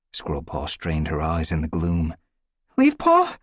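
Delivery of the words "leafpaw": high, loud questioning call; searching call. Acted. Squirrelpaw searching for Leafpaw in the gloom, calling. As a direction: call into the dark for her.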